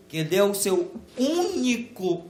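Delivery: ordinary delivery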